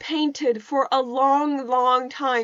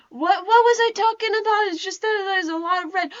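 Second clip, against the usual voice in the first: higher-pitched Southern twang; Southern lilt popping out, pitched up; Southern accent; 5 semitones higher than usual. Real, not acted. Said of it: "I think that was Southern again".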